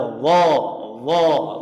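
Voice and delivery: "Allah" thick and heavy. Shown as a demonstration. Teacher confirming the student's thick Allah. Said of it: "Tebal ya".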